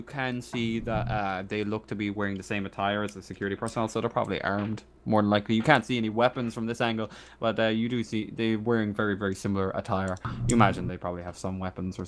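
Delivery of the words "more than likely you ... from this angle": normal talking voice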